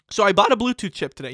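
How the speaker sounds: ordinary talk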